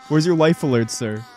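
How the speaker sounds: ordinary talk, nothing marked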